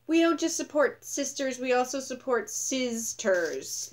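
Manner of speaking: high, sing-song mockery, slowing at the end; mocking sign voice. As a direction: read the slogan like it's a silly joke. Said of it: "That stupid ass sign that's like".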